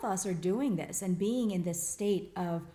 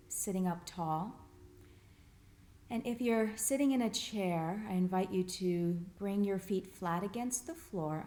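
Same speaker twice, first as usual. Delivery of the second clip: guided meditation. Real, not acted. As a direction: gently guide them through settling in, pausing between phrases. Leads group body scan.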